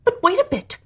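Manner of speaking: high, quick little cry of alarm; oysters protesting. Acted. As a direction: squeak out a plea to wait.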